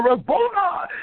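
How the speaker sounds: a high, ringing cry of recognition; cried out. Retold or imitated. Mary cries out to the risen Jesus, high and joyful. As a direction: call the name high, in sudden recognition. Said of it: "she cried out".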